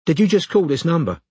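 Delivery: high-pitched London woman; suspicious Londoner on the phone; London accent. A narrator's reading. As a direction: question the caller in a London accent. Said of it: "She had a London accent".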